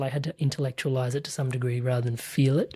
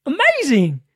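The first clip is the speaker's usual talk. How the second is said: high, loud gushing; hammed-up proud parent gushing; mock gushing praise; 11 semitones higher, 7 dB louder than usual. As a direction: gush over the child's little win. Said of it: "it's always just like".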